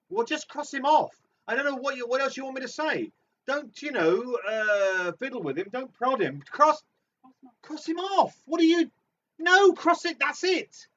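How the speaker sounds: high loud exasperated rant; fed up, ranting at the card. Acted. Exasperated crossing off cards.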